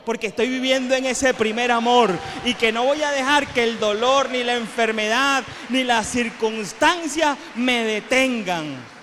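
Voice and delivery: faithful declaring that pain will not stop them; bold declaration. Retold or imitated. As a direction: proclaim it like nothing will stop you. Church members declaring their faith.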